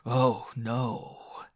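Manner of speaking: drawn-out mocking denial; sneering. A narrator's reading. Man dismisses the woman's pain, sneering. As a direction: sneer at the complaint. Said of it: "he sneered".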